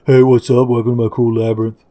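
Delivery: lightly voiced spooky host, fast; a hint of a haunting guide. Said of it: "the spooky voice is gonna be like".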